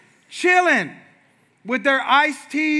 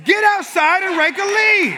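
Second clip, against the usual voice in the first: loud, slow, firm command; barked order; 9 semitones higher, 8 dB louder, slower than usual. Retold or imitated. Dad sends whining son back outside, loud firm order. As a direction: snap him back out to work. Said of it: "hand a little bit".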